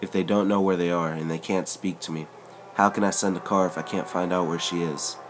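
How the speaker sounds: ordinary delivery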